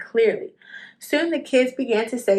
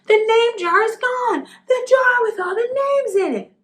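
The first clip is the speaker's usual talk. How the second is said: high excited shout that the jar is gone; 12 semitones higher, 4 dB louder than usual. Acted. Boy shouting to the teacher the jar is gone, high and loud. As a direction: cry out the news, pitched way up. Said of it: "Ralph shouted at him".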